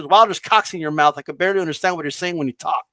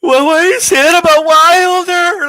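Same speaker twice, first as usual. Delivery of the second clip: laughing; 9 semitones higher, 7 dB louder than usual. Real, not acted. Laughing after cohost's line.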